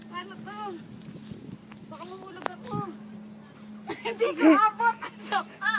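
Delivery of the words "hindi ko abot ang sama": laughing through the complaint; helpless giggle at falling short. Real, not acted. Laughing at failing to reach.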